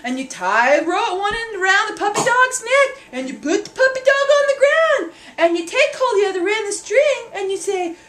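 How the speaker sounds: usual speaking voice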